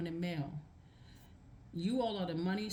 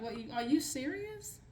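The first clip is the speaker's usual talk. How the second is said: disbelief; 7 semitones higher than usual. Retold or imitated. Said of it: "It's like".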